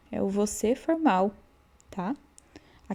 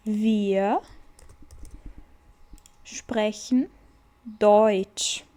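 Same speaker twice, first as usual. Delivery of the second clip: slow model sentence; much slower than usual. Shown as a demonstration. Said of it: "Vamos repetir".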